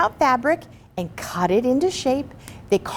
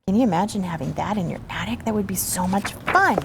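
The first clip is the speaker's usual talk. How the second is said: ordinary delivery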